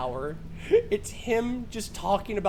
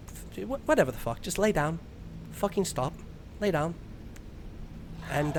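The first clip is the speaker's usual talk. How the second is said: lightly done Australian bedtime voice; Australian sleep-talk host; 4 semitones lower, 5 dB quieter than usual. Retold or imitated. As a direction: lull the listener like a laid-back Aussie. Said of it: "starts with him going".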